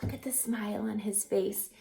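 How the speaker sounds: quick delighted whisper. Real, not acted. Teacher showing kids the bear's happy face, whispering. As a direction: point at the picture and whisper.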